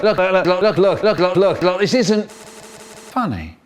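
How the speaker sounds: acted giggles breaking into high repeated words; helpless laughter. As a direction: try to protest while cracking up. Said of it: "He starts to laugh".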